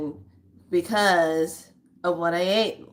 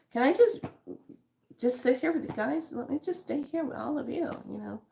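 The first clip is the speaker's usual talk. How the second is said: high, soft, wheedling plea; mock pleading; 7 semitones higher, 4 dB quieter than usual. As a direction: beg to stay, higher and softer. Pleading to stay with guests.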